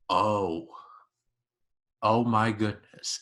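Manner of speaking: hushed-pitch, sinking disbelief; stunned realization. Retold or imitated. Viewer grasping the villain's scheme, stunned. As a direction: let it dawn after a pause.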